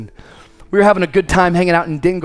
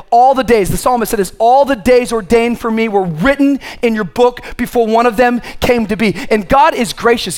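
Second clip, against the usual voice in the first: raised, rhythmic preacher's cadence; emphatic preaching; 6 semitones higher, 4 dB louder than usual. Acted. Preacher assuring the congregation of God's provision, emphatic preaching. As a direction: drive each promise home with punched key words.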